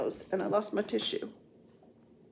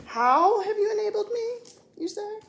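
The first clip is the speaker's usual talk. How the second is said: high, put-on questioning voice; mock questioner; 13 semitones higher than usual. Vlogger voicing Denise's question about enabling her, mock high voice. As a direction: pitch it way up in playful mock wonder.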